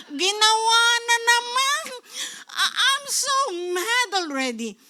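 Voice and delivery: high, hammed-up tearful complaint; mock exasperated whine. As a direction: wail it like someone fed up, overdone. Acts out wronged person's complaint.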